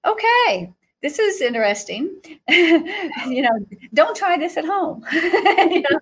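high okay, then laughter; amused nervous okay. Retold or imitated. Holding a burning hoop in the ring, amused and laughing. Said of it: "I'm like".

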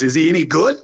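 Trump impression. President asking the visitor's friend about him, Trump impression. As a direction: imitate the president, lifting into the question. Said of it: "Is that your Trump in, impression".

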